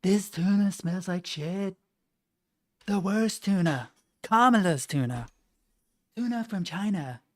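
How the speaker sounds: a loose imitation of Trump's cadence; Donald Trump impression. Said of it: "Is that your Donald Trump accent?".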